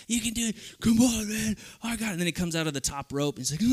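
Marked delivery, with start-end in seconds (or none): 0.1-2.1 urgent encouragement. Retold or imitated. Wrestler urging tag partner.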